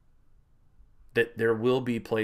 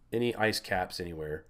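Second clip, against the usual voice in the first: ordinary talk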